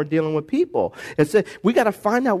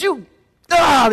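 higher, louder burst of rage; mock angry strike; 7 semitones higher, 4 dB louder than usual. Moses striking the rock in anger, higher and louder. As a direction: hit it like Moses losing his temper.

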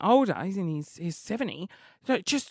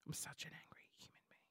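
breathy whispered confession; 4 semitones lower, 30 dB quieter than usual. Real, not acted. To listeners, admitting her temper in a whisper. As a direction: say it under your breath, voice gone.

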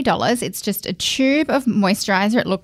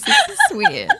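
much higher, giggling in disbelief; awkward laugh. Real, not acted. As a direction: laugh it off, a bit uncomfortable. Laughing at her crying cohost.

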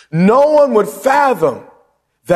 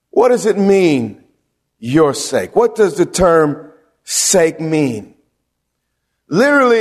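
ordinary talk, nothing marked

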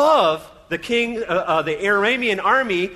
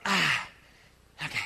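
ordinary talk, nothing marked

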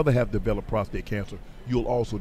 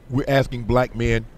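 ordinary delivery

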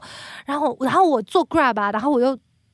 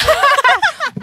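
high burst of laughter; retelling her own tantrum, a high embarrassed laugh; 14 semitones higher, 7 dB louder than usual. Real, not acted. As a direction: laugh at yourself, high and loud.

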